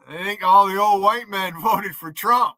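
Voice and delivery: shouted through laughter; laughing shout. Real, not acted. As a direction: crow it out, cracking up. Mocking laugh at the joke.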